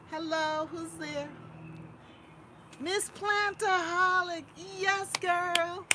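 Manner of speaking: high, loud greeting; excited greeting. Real, not acted. Vlogger greeting a viewer in chat, high and loud. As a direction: call out a happy welcome.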